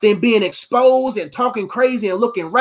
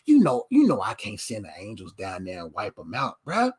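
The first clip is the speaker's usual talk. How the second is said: low-pitched, lightly voiced God turning down a request; God's reply; 12 semitones lower, 5 dB quieter than usual. God refuses to send angels after the man, lightly voiced low protest. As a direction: play a reluctant God, dropping low. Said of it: "God's like".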